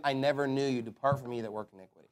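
low, hushed voice of God turning them away; a hushed, stern I never knew you; quiet divine rejection. Acted. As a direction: say it softly, a final verdict.

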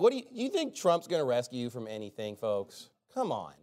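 ordinary talk, nothing marked

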